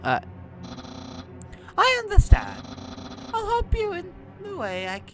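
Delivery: very high, swooping cartoon voice; high-pitched, theatrical underling answering the boss; high cartoon character pleading. Underling promising help to a superior, high cartoon voice.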